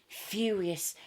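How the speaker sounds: plain reading voice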